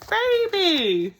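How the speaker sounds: high, loud cry of shock; shocked discovery. A narrator's reading. Burglar finding a baby, shocked. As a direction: burst out with the shock of it.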